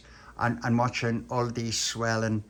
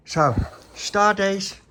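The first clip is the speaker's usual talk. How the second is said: loud, slow, higher announcer voice; captain's log announcement; 8 semitones higher, 8 dB louder, slower than usual. Acted.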